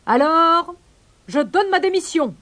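high, exasperated pelican shout; crying out. A narrator's reading. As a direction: stretch the first word, then proclaim it high. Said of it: "cria le pélican".

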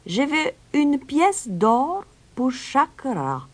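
high, slow, deliberate stranger's voice; stranger naming price. A narrator's reading. Stranger states his fee to the mayor, high and deliberate. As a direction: spell out the terms slowly, pitched high.

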